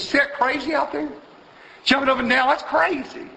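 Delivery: high-pitched imitation of a scornful lady; mock an onlooker, pitched high; lightly voiced lady passenger, high; scoffing bus passenger.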